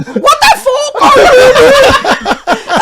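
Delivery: very high, loud, slow shout, laughing; retelling shouting in court, laughing hard; retold shout. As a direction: scream it half laughing. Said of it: "And I shouted at him".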